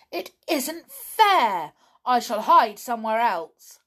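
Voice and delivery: stamp your foot in protest; angry complaint. A narrator's reading. Donald protests loudly to Tom that it isn't fair.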